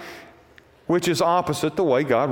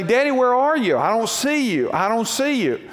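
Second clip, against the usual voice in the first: put-on boy crying out; 6 semitones higher than usual. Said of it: "the little boy's crying out".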